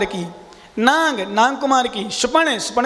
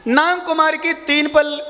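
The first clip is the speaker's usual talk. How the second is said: ordinary talk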